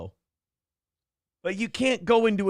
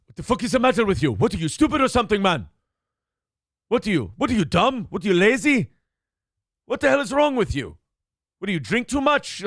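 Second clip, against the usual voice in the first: rapid, raised-voice berating; imitated yelling boss; 3 semitones higher, 3 dB louder, faster than usual. Mimicking the Greek owner cutting into his staff, angry yelling. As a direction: tear into a worker in quick, loud questions. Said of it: "he would yell shit at them".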